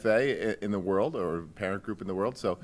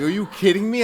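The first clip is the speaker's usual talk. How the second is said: higher, louder mock outrage; replaying the rant, pitched up and loud; retold tirade; 11 semitones higher, 9 dB louder than usual. Principal retelling his rant at the superintendent, mock outraged shout. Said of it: "I went into a tirade".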